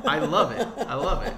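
loud, high, laughing through the words; laughing delight. Real, not acted. Delighted at a film performance, laughing real hard. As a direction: crack up while gushing. Cracking up after own impression.

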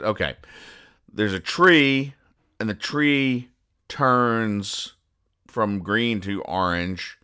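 ordinary talk, nothing marked

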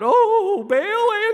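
high, loud wail imitating desperate worshippers; mock crying out. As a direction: wail it high like a hammed-up plea. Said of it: "they cry out".

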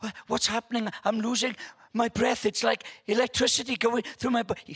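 high, hammed-up panic; pretend alarm. Granddad plays along with fairy dust, mock breathless panic. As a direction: play along as if magic is overpowering you. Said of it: "and I go with it".

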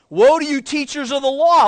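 loud, stern rebuke read from the pulpit; forceful scripture reading. Acted. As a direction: read it like a prophet's warning. Preacher reads Jesus' rebuke.

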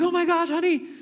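high, delighted gasp of a proud parent; amazed parent. Retold or imitated. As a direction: gush over the baby standing. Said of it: "And then you're like".

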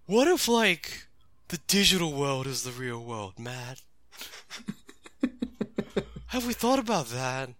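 a baked deep thought to his partner; stoner Gabumon. Retold or imitated. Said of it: "he just normally went to Brandon going".

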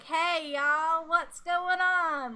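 loud, high, drawn-out Southern greeting; exaggerated Southern accent. Shown as a demonstration. Walking up to a group of people, hammed-up Southern accent. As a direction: ham up a Southern drawl, calling out. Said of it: "turning Southern".

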